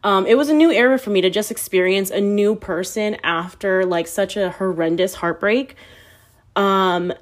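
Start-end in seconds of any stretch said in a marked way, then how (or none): none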